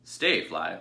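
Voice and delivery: loud, firm order to the dog; farmer's command. Acted. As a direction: call the dog to stay.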